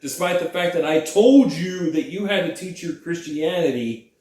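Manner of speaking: loud, hammed-up outrage; mock indignant professor. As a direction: tear into the student in mock fury. Acts angry professor confronting student.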